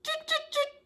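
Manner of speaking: very high, squeaky little tuts; monkey chatter. A narrator's reading. Monkeys shaking fingers back at the peddler, high chattering. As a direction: mimic cheeky monkeys, tiny and high. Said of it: "monkeys only stamped both their feet back".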